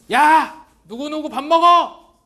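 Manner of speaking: loud, high shout across a playground; parent calling kids. Retold or imitated. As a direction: yell for the kids to come eat.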